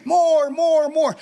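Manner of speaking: high mock crowd chant. Women cheering for an encore, imitated high yelling. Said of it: "they're yelling for more".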